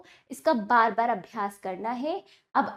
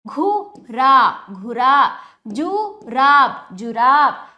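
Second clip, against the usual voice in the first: घुरा and जुराब loud, split then blended; slow, projected drill on घुरा and जुराब; broken, joined pronunciation; 6 dB louder, slower than usual. Shown as a demonstration. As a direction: stretch each syllable, then join the word. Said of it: "तोड़कर जोड़कर उच्चारण".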